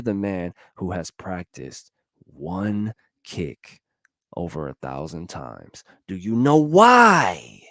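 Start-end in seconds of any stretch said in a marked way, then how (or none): 6.1-7.6 dramatic question. Real, not acted. Rhetorical question to the audience.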